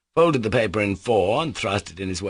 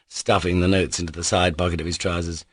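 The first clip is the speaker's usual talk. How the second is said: usual speaking voice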